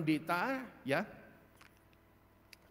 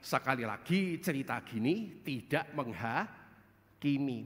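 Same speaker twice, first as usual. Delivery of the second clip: ordinary talk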